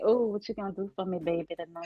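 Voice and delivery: put-on New Orleans accent, flirting with a man on a date; sexy New Orleans accent. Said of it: "so what you say?".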